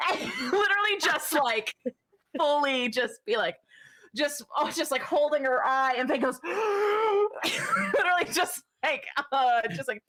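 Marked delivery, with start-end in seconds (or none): none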